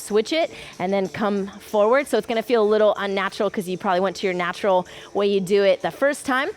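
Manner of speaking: calm cooldown voice. Acted. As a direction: ease them gently into the stretch.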